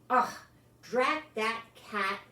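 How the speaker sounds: a put-upon father cursing the cat; fed-up dad. Acted. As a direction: Mr Thomas grumbling about the crushed flowers, exasperated. Mr Thomas annoyed at crushed flowers.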